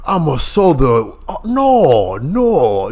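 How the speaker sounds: slow, stretched-out southern drawl; hammed-up southern gentleman drawl; Daniel Craig southern drawl. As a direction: drag the vowels out in a mock Southern accent. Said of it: "Daniel Craig in that movie?".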